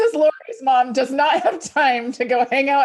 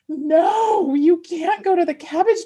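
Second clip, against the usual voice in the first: a hint of a whining little kid, higher; whiny child; 7 semitones higher than usual. Adult friend voicing her inner child's protest, lightly whiny. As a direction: whine it like a child denied a toy. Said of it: "the young child in me is like".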